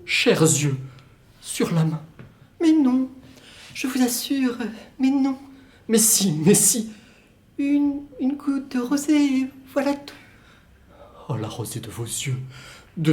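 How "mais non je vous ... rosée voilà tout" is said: put-on higher, dreamy young woman's voice. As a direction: play the distant lover, light and airy. Woman's part in two-voice scene.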